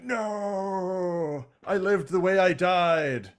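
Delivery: high, shouted mock noble farewell; hammed-up heroic last words. Skylinks proclaiming last words, high and shouted. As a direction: proclaim your epitaph. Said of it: "Skylinks is like".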